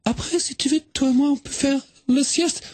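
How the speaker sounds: put-on a tourist girl explaining herself in French with a Swedish accent. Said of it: "mais quel joli accent!".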